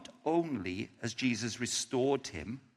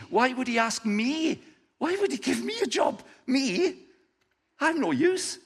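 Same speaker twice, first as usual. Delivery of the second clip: much higher, rising disbelief; incredulous Peter; 10 semitones higher, 4 dB louder than usual. Acted. Peter unable to believe Jesus wants him, high. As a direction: voice climbing in disbelief.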